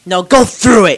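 loud mock command; shouting. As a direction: shout it at the drone. Mock urging the drone.

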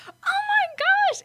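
very high, slow, teary squeal; mock squeal. Mom squealing as daughter wins, mock teary. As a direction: squeal it out overjoyed.

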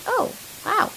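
breathy whispered, very high fake amazement; overdone wonder at a small favor; mock wow. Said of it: "I'm like".

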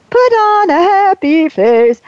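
high, falsely bright happy face; fake cheery voice. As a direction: put on a forced cheerful tone. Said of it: "I don't mean".

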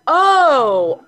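high, loud retold "oh" of realization; dawning realization. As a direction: act out a sudden realization. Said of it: "I was like".

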